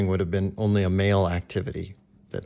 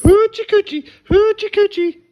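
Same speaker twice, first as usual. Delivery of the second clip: silly singsong; 10 dB louder than usual. Pretend. Parent teasing about dancers.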